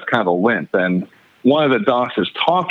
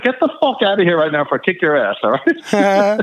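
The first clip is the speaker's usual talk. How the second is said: mock warning, raised pitch; retold threat; 7 semitones higher than usual. Retelling his warning to the limping stranger, mildly angry. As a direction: replay a fed-up threat without shouting.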